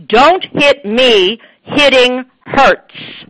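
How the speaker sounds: put-on firm assertive child's script; firm, strong version. Shown as a demonstration. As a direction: say it with full force to stop the hitter. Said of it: "if you want them to learn how it sounds".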